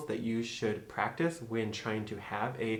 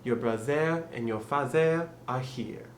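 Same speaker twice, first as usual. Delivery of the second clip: French accent, "brozeh" and "fazeh"; French-accented practice phrase. Shown as a demonstration. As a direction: say it French, TH as Z in brother and father. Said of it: "So you'd wanna say".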